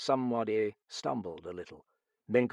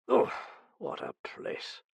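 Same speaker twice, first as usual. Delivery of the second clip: shuddering distaste; 13 semitones higher than usual. A narrator's reading. Englishman fumbling at his beard in the dim room, shuddering. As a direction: let it out under a shudder.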